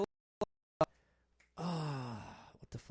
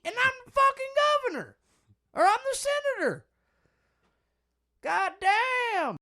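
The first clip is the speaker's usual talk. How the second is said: much higher and louder, building to a shouted southern rant; senator impression; 13 semitones higher, 9 dB louder than usual. Mocking the senator to his friend, southern accent shouting. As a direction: ham up an outraged southern politician. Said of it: "I did sound pretty close".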